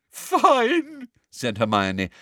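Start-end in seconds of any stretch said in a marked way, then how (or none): 0.1-1.1 high, put-on sob; girl fake-sobbing to her teacher. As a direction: sob the word into her hands. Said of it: "now sobbing into her hands again".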